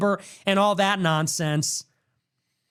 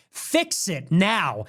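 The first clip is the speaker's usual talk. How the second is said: ordinary delivery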